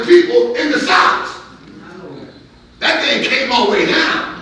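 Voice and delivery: loud, high, driving sermon voice; full-force preaching. Real, not acted. Preacher warning how far judgment spread, full-force preaching. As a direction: drive the judgment home at full volume.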